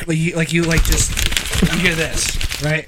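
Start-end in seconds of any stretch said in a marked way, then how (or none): none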